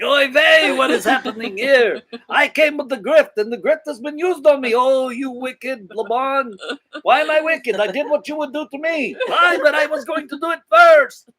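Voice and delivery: put-on high, loud Yiddish accent voice; kvetching in a Yiddish accent. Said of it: "Kinda like that".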